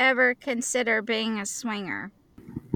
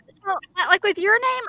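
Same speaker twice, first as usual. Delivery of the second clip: ordinary delivery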